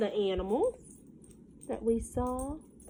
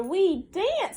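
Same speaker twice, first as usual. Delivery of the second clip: high little chick voice, rising; baby chicks; 10 semitones higher, 3 dB louder than usual. Acted. Chicks answering their mother in the rhyme, high and chirpy. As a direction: pipe it up like tiny chicks.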